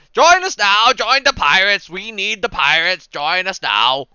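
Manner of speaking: loud, high, drawn-out recruiting call; burly crier shouting. Acted. Burly fella on a milk crate recruiting pirates, shouting. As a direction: holler it slowly to a crowd. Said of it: "the man continues to look around".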